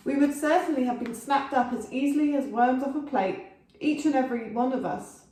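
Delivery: usual speaking voice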